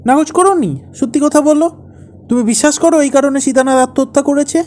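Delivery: high, loud, demanding questions; angry challenge. Acted. Husband demands wife's honest verdict on his story, angry. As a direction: corner the listener with heated questioning. Husband cross-examines wife in quarrel.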